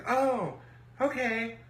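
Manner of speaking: high, sing-song imitation; mimicked rival woman. Rival hearing how many are left, high mimicking voice. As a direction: put on her high "oh, okay".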